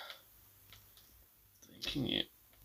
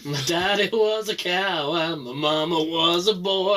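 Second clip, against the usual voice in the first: put-on slow drawling southern cowboy accent; slower than usual. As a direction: play it as a slow, swaggering Texas cowboy. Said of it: "the only one who can do a southern accent".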